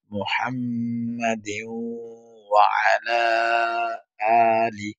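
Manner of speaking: slow recitation, with ghunna held in মুহাম্মাদিন before ওয়া; slow teaching recitation with a held nasal; slow recitation with nasal hold. Shown as a demonstration. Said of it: "কেন গুণনা করলাম".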